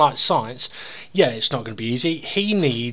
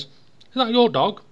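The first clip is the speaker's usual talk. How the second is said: higher, slower polite query; lightly voiced, raised-pitch question; joke's Englishman; 8 semitones higher, 3 dB louder, slower than usual. Englishman asks Welshman about his dog, lightly voiced higher query.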